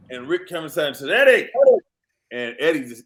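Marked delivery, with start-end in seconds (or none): 1.1-1.5 calling out. Retold or imitated. Calling out to host.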